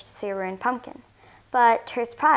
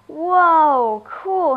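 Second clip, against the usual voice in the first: long, high, loud wow of delight; amazed; 5 semitones higher, 8 dB louder than usual. Acted. Girl spots a tiny spaceship, amazed. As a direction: marvel loudly at it, high-pitched.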